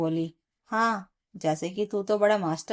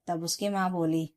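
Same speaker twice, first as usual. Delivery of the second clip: ordinary delivery, nothing marked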